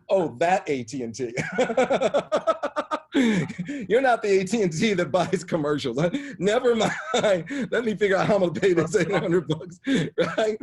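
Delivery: loud, high laughter through the words; cracking up at his own mistake; laughing helplessly while retelling the phone call. Real, not acted. Laughing at own embarrassing mix-up.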